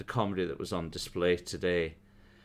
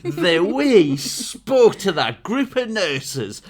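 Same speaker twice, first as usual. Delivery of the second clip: high, loud, drawn-out mock outrage; imagined angry outburst; 8 semitones higher, 7 dB louder, much slower than usual. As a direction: stretch each insult in hammed-up indignation. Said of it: "focus her anger on me".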